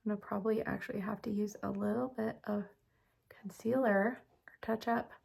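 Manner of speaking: whispered aside to herself; whispering. Real, not acted. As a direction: drop to a whisper, thinking aloud. Muttering to herself applying makeup.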